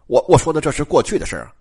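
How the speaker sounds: ordinary delivery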